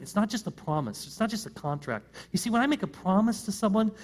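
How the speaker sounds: usual speaking voice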